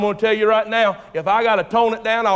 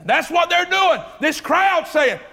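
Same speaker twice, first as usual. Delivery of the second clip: preaching; 8 semitones higher, slower than usual. Acted. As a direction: preach it out with full force. Preacher building to the crowd's cheer.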